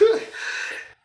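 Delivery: quick high chuckle; laugh. Real, not acted. Man recalling starting up stolen cars, quick chuckle. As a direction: let out a short, high laugh. Laughs after describing car thefts.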